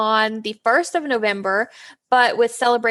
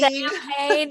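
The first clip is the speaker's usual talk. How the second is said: mock Georgia accent; 3 semitones higher than usual. Echoes co-host's joke mispronunciation.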